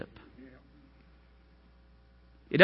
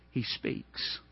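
breathy whisper, almost no voice; 3 semitones lower, 10 dB quieter than usual. Acted. Preacher hushing the congregation, whispered. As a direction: drop to a hushed whisper as if confiding. Hushed aside after storm image.

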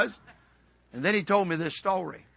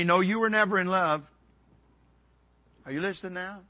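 normal talking voice

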